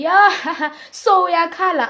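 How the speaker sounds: imitating a friend's mocking laugh. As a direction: laugh at her while you say it. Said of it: "She even laughed at me".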